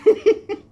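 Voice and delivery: sudden high whooping laugh; loud burst of laughter. Real, not acted. Laughs at her own joke.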